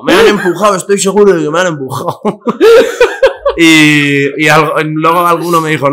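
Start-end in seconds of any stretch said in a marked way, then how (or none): 0.0-2.2 higher, insisting he was pushed; mock drunk indignation, pitched up. Drunk [name] swearing to the bystanders he was pushed, higher insistence. 2.2-3.5 high laugh. Real, not acted. Laughing at his own drunk excuse, high burst of laughter.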